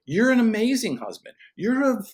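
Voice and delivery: high, bright-hearted voice of God, encouraging; voicing God. God telling a doubting dad he is good, in a high voice. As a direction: speak as God lifting up a discouraged father. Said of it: "letting the Lord look at you and go".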